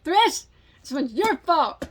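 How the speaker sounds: mock blame. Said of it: "that was a dry humor joke".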